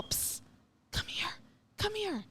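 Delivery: hushed urgent psst calling; retold whisper. Narrator imitating the voice calling the creature in hushed tones. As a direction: call softly down the stairs. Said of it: "like, yelling at this thing".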